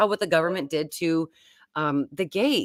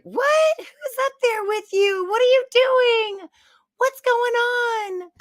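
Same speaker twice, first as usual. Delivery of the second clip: put-on much higher, slow puzzled child voice; imitating the kids; 4 dB louder, slower than usual. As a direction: ask like bewildered small kids calling upstairs. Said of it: "my kids are like".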